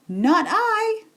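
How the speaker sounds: a lazy cat turning up its nose; high cat voice. Acted. Cat refuses to help.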